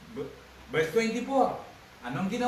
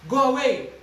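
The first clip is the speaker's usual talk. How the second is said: a loud, high "Go away!" as Jesus; commanding shout; 8 semitones higher, 9 dB louder than usual. Acted. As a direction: drive the mourners out with a shout. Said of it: "Ang sabi niya". (malakas na sigaw ni Hesus na nag-uutos)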